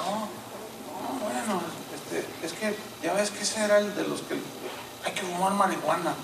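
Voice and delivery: hushed, halting retold answer; reluctant explanation. Old friend explaining a death, quiet and reluctant.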